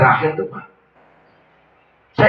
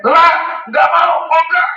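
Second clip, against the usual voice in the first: higher, louder, slower refusal; shouted refusal; 8 semitones higher, 3 dB louder than usual. Retold or imitated. Dying imam refusing the third time, shouting. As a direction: shout him off stubbornly.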